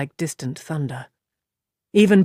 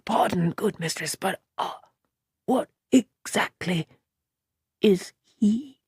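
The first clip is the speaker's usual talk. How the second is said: softer, confidential question; dropped voice; 2 semitones higher, 5 dB quieter than usual. A narrator's reading. Simeon leaning close to Moraine, asking softly. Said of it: "dropped his voice".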